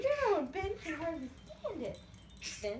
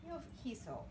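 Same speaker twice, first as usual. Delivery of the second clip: whispered aside; 11 semitones lower, 11 dB quieter, faster than usual. Real, not acted. Aside to partner.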